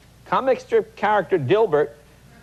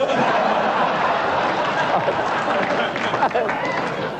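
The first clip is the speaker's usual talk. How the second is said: airy laugh at the clipping; breathy laugh. Real, not acted. Host cracks up at a newspaper quote, breathy laugh.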